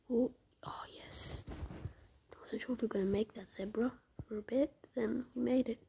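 usual speaking voice

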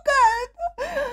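very high, slow wailing squeal; near-tearful fangirl gush; overwhelmed. Real, not acted. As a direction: wail it high, overcome with joy. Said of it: "I'm so overwhelmed".

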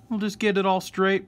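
ordinary talk

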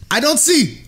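put-on high Russian-accented complaint; Russian grandmother. Nurse to patient hunting a vein, Russian grandmother accent. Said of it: "she's a Russian grandmother".

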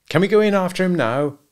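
itching to get going; eager. A narrator's reading. Husband asks to go after the bull, eagerly. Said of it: "asked Macumber eagerly".

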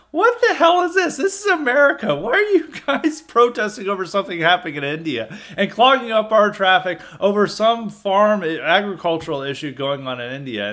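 mock-outraged what the hell; incredulous outburst. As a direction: react as if stunned by the traffic jam. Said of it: "And I was like".

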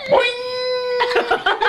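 high shouted boing; excited shriek. Pretend. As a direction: shriek it with glee. Bounced, shrieking.

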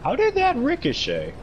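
high, incredulous question; surprised. Real, not acted. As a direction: squeak out your disbelief. Surprise at ricochet.

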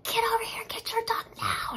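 high, urgent yell for help; retold scream. Dog owner yelling at frozen neighbor to grab his dog, screaming. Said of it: "I screamed that".

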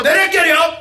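loud, high, yelling rant; furious shout. Retold or imitated. Guy on Sergels torg flipping out at his girlfriend, shouting. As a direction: scream at her, fed up. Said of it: "Han bara".

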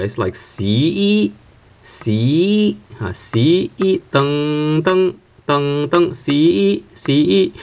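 "C" and "deng" slid upward from low to high; rising tones. Shown as a demonstration. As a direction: start each "C" low and slide it up. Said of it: "rising tone is you start with a lower pitch".

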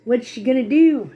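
usual speaking voice

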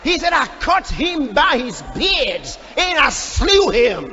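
loud, shouted pulpit delivery; impassioned preaching. Real, not acted. Preacher retelling David's fight with the lion, impassioned shout. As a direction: hammer the story home at full force.

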